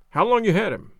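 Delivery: brisk, businesslike question; sharp, businesslike. A narrator's reading. Stranger questioning the dog's owners, sharp and businesslike. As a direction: ask it sharply, all business. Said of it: "in a sharp, businesslike manner".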